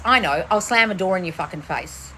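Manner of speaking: ordinary talk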